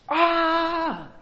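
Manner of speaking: high anguished wail of a grown man; shouted cry. Retold or imitated. Preacher acting Esau's bitter cry, anguished wail. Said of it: "So the guy shouts".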